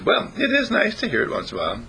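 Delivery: high, quick rabbit voice; flattered rabbit. Acted. Rabbit takes bunny's compliment, flattered in a high quick voice.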